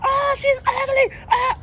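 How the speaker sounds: very high, loud slow screech; hysterical parrot. Retold or imitated. Hysterical parrot screeching at the lady. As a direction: shriek like a frantic bird. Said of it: "The parrot went hysterical".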